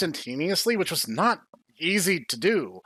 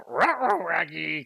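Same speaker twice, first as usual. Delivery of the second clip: Scooby-Doo voice. Retold or imitated. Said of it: "do the Scooby-Doo voice".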